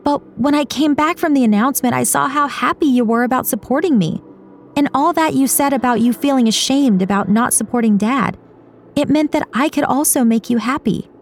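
high-pitched daughter explaining herself; emotional pleading daughter. Acted. Daughter explains to her mother why she accepted, pleading.

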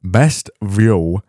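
broad, hammed-up Yorkshire accent; best Yorkshire accent. Host reading listeners a quote, best Yorkshire accent. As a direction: put on your thickest Yorkshire for a motto. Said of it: "do it in my best Yorkshire accent".

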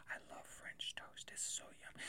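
breathy whispered confession; hushed aside about French toast. Real, not acted. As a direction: whisper it like a guilty pleasure.

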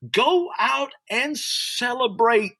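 high, slow preached exhortation; preached call. Real, not acted. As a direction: stretch it out from the pulpit. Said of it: "I wanna preach right now".

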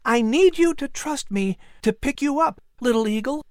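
put-on high-pitched boy's voice, asking for trust; coaxing boy. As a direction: coax a nervous little bird in a high voice. Boy coaxes nervous eagle.

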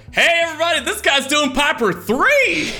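high, loud hype call to the room; rally the whole bar. Acted. Player calling everyone over to watch, high loud call-out.

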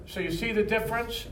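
usual speaking voice

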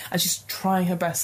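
normal talking voice

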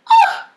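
high laughing shriek of panic; mock panic squeal mid-story; laughing yelp. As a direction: let out a startled laughing cry. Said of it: "y yo".

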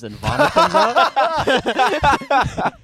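high, loud and very slow through laughter; can't get the words out for laughing; cracking up mid-story; laughing while telling. Real, not acted. Laughing while retelling the vomiting.

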